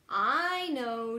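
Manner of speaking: ordinary delivery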